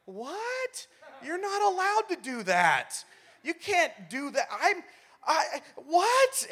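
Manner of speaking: very high, incredulous outburst; mock shock. Kid reacting to parent's decision, mock shock. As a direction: squeal in hammed-up disbelief, building to a loud what. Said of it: "you're just completely shocked by it".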